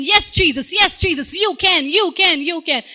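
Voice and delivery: pumped up. Retold or imitated. Believer pumped up, cheering yes Jesus. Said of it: "excited and pumped up and you're saying".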